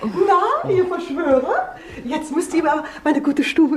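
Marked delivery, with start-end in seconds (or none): 0.0-1.8 slow, amused teasing; landlady teasing the conspirators; teasing laugh. Acted. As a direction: laugh as you catch them whispering. Landlady teasing her tenants.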